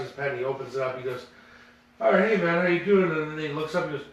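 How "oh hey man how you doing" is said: higher, louder hearty hello; a hockey star loudly greeting a fan; mock loud greeting. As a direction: boom out a big friendly greeting. Ty Domi's loud greeting, retold.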